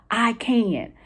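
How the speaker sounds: CAN stressed and stretched out. Shown as a demonstration. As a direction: put the emphasis on the second word, can. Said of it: "then I would go to the second word".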